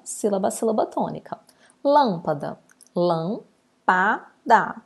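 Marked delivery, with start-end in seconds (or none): 2.9-4.8 "lampada" broken into lam, pa, da; split into syllables. Shown as a demonstration. Teacher separating the word for pupils, "lampada" syllable by syllable. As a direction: pause after each syllable of "lampada". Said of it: "eu separo".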